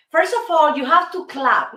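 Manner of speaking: ordinary talk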